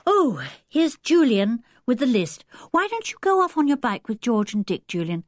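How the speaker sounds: put-on high, lively girl's voice; happy Anne. Anne urges Julian to go cycling, happy.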